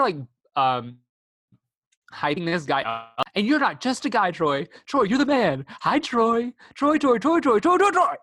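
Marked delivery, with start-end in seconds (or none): 3.3-8.2 high, loud fan chanting; mock hype. Host imitates everyone hyping up Troy, high mounting cheer. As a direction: build to a frantic high repeated cheer.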